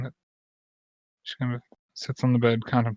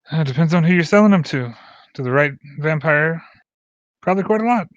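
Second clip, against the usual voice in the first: higher, louder, amused sales estimate; smirking; 4 semitones higher, 8 dB louder than usual. Acted. Esmeralda answers a question about selling his bones, smirking. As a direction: play it slyly amused and louder. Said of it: "She kind of smirks and chuckles".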